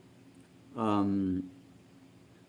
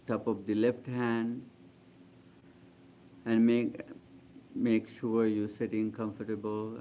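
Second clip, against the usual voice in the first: calm, spacious meditation-leader voice; guided meditation. Real, not acted. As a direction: gently guide them into stillness, voice settling down.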